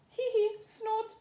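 high slow giggle and snort; high snorting laugh; piglet giggle. A narrator's reading. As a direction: giggle like a tiny pig. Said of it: "giggles George".

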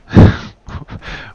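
loud, breathy burst of laughter. Real, not acted. Player mocking the game's looks, loud laugh. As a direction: burst out laughing at your own jibe.

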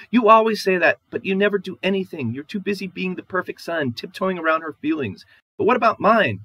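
high, loud, aggrieved outburst; wife protests to her husband about his mother, high and loud; upset wife. Acted. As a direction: pour out your grievance against him.

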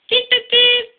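high, even train-whistle beeps; whistling beeps. A narrator's reading. Percy signalling the others, whistling beeps. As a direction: toot like a little engine. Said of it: "whistled Percy".

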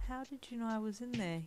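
small, soft wondering voice; quiet, curious. A narrator's reading. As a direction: ask quietly, with shy curiosity. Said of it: "you asked quietly yet curiously".

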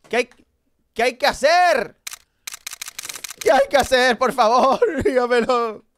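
high, loud pleading whine; whiny plea. Real, not acted. Streamer begging the game for the answer, whiny. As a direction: beg the game for help.

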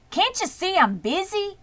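put-on a boy fed up with fussing; disgusted. A narrator's reading. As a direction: snap back in disgust. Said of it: "replied Avery in disgust".

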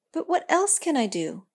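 a touch of begging for help; pleading. Acted. Girl asking the tree for advice, mildly pleading. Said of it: "pleaded the girl".

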